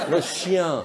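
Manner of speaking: normal talking voice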